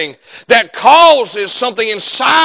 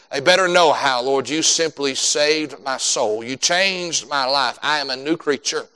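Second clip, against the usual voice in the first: lower, softer praying voice; earnest prayer; 5 semitones lower, 6 dB quieter than usual. Real, not acted. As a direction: pray humbly and earnestly, voice kept low. Leads an opening prayer.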